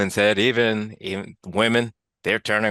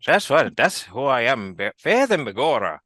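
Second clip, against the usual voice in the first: bad Irish accent; 5 semitones higher than usual. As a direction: overdo a leprechaun Irish voice. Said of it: "and here's my accent".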